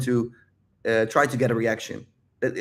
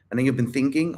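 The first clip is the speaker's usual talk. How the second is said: usual speaking voice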